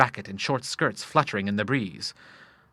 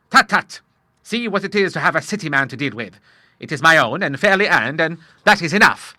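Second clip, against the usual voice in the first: higher, loud, emphatic brush-off; hearty Admiral; 5 semitones higher, 6 dB louder than usual. Acted. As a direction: wave away questions as a bluff father.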